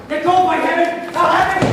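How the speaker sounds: forceful shout. Acted. Hamlet wrestles cup from Horatio.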